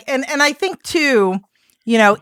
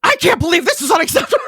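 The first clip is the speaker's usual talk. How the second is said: mock harsh outburst; 6 semitones higher, faster than usual. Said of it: "So I'm gonna sound really pissed and harsh".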